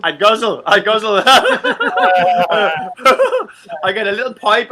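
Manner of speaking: chuckling through the words; real laughter at the joke; laughing. Real, not acted. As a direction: laugh while you say it. Laughing joke about drinking.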